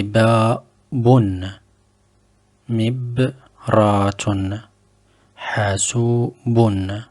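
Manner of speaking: babun, mibratun, hasubun, each drawn out slowly; slow repetition. Shown as a demonstration. Teacher repeats the word list slowly. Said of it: "je répète".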